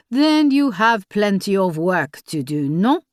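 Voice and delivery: put-on lightly French-accented, dismissive official; a hint of a curt French commissioner. A narrator's reading. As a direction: brush her off in a French accent.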